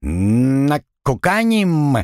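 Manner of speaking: slow squawked parrot word; bird folk. Acted. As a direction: drag it out like a parrot. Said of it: "внезапно чирикнул Пэт".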